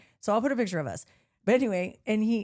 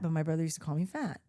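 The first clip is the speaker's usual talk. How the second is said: normal talking voice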